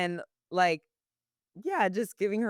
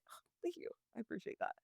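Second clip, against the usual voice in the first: soft grateful whisper; 5 semitones higher, 15 dB quieter, much faster than usual. Retold or imitated. Grateful barista reaction.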